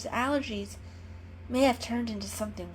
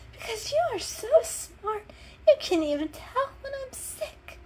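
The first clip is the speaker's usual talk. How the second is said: very high, teary sarcasm; sarcastic sick kitten. A narrator's reading. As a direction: sneer through tears at her friend.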